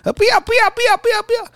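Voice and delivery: put-on very high, squealing toddler no; high mock toddler protest; toddler refusing. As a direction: whine it like a stubborn two-year-old. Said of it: "我说：".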